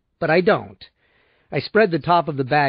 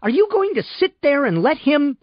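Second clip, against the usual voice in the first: much higher, sharp and rising; shrill; 11 semitones higher than usual. Acted. Belle demanding that Miles defend her, shrill and much higher. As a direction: screech it at him, outraged. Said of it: "she said shrilly".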